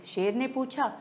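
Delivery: ordinary delivery, nothing marked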